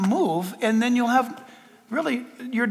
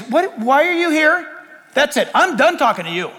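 higher-pitched mock indignation; dismissive rebuke; 5 semitones higher than usual. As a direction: act out an exasperated brush-off. What God never says, dismissive.

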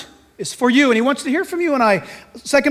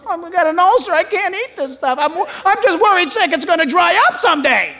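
fretting bird voice; 6 semitones higher than usual. Pretend. Imagined worried bird voice.

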